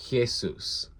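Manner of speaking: "Jesus" with a guttural h, hyeh-SOOS; "Jesus" as Hyeh-soos, h from the throat; guttural Greek pronunciation. Shown as a demonstration. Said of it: "actually a guttural pronunciation, so it sounds like".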